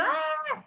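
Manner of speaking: very high mock yell answering back; mock shout back. Showing how the parent yells back too, high mock shout. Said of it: "y yo también grito".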